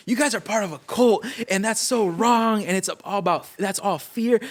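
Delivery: high, heated accusation at his friends; retold outburst. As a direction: pitch shoots up as he lets them have it, words dragged out. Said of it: "I just went crazy on 'em".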